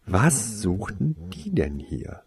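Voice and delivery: plain reading voice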